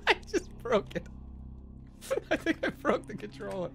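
high laughing talk. Real, not acted. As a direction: laugh through the words. Laughing after breaking puzzle.

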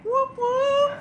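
very high-pitched celebratory whoops; high cheering whoop. Real, not acted. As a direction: whoop along in a high squeal. Cheering after the reveal.

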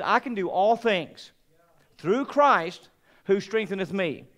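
slow, solemn recitation; scripture quote. Retold or imitated. Preacher reciting a Bible verse to his church, solemn. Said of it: "he says".

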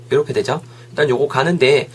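usual speaking voice

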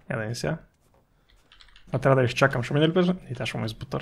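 normal talking voice

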